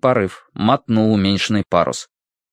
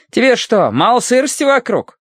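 put-on high, squabbling old woman voice; foul-mouthed granny; 13 semitones higher, 3 dB louder than usual. A narrator's reading. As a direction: scold like a quarrelsome granny. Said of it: "голосом скандальной бабки".

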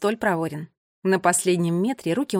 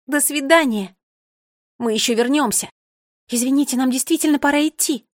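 louder, higher, hurried goodbyes; excited children taking their leave; eager children chiming in; 4 semitones higher, 5 dB louder than usual. A narrator's reading. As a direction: call out over one another. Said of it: "наперебой заговорили дети".

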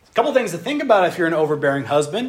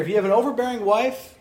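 ordinary talk, nothing marked

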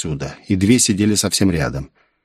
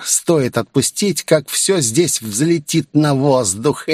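higher, slow, drawn-out villain's threat; slow, pitched-up menacing bad guy; growling villain; 5 semitones higher, slower than usual. A narrator's reading. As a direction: stretch the threat out in a put-on villain voice. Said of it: "прорычал он".

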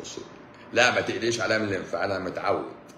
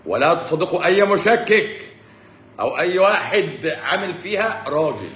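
slow preacher's delivery, climbing higher and louder; mock sermon; 4 semitones higher, 5 dB louder, slower than usual. Host warns his audience about doubters, mock-solemn preaching. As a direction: preach it grandly, drawing out the phrases.

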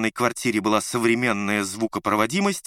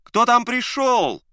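calling from another room; 13 semitones higher, 8 dB louder, slower than usual. A narrator's reading. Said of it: "донёсся из комнаты голос".

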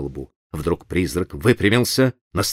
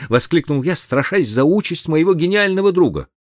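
plain reading voice

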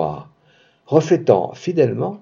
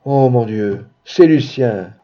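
plain reading voice

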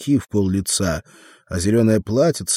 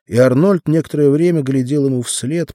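usual speaking voice

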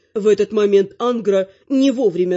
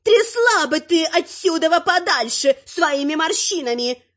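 high-pitched snarling she-troll; growling troll woman; 9 semitones higher than usual. A narrator's reading. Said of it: "рычит троллиха".

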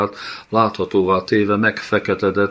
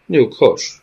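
loud, higher barked order; Kán shouts at the undead child to be still; shouted command; 6 semitones higher, 6 dB louder than usual. A narrator's reading. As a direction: shout him down. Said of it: "kiáltotta Kán".

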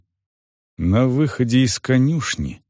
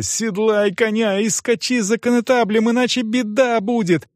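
put-on high-pitched girl giving frantic orders; crying out urgently; 10 semitones higher than usual. A narrator's reading. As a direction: insist and threaten in a high voice. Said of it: "крикнула девочка".

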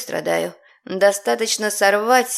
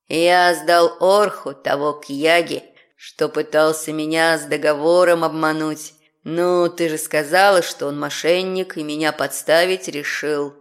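proudly rumbling demon guard; 6 semitones lower than usual. Acted.

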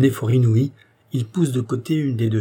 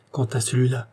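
plain reading voice

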